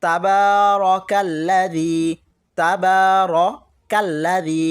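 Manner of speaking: তাবারাকাল্লাযী with a full long ka sound, slow and drawn out; correct tabarakallazi. Shown as a demonstration. Teacher modelling the right way for students, slow and careful. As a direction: say তাবারাকাল্লাযী slowly, stretching the কা. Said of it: "আবার আমরা বলি".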